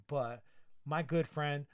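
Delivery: ordinary talk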